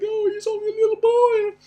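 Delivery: very high, loud mock-anxious mom wail; squealing mock mommy fuss; freaking-out mother. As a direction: imitate a fretting mother pleading for her boy. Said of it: "I could feel her freaking out".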